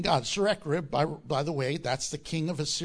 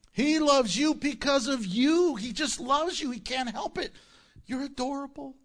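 tender; 8 semitones higher, 4 dB louder than usual. Real, not acted. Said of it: "tender aside to congregation".

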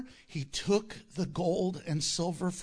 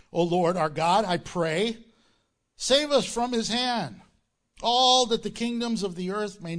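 loud, rising prayer read to the congregation; scripture reading; 3 semitones higher, 6 dB louder than usual. Acted. Pastor reading the king's prayer aloud, fervent. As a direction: proclaim the prayer with force.

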